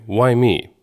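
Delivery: ordinary talk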